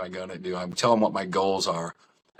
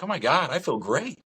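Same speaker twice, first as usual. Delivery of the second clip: excited; 7 semitones higher than usual. Retold or imitated. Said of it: "I was like".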